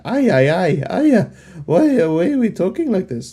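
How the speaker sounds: high, loud mock Mexican accent; hammed-up Mexican movie accent; cliche Mexican accent. Mocking dubbed villains in a hammed-up Mexican accent. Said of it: "don't judge me on that accent".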